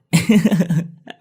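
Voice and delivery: put-on high, loud cackle of a proud child; smug laugh. A narrator's reading. Dậu laughs, pleased with his own boast, smug cackle. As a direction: laugh in triumph.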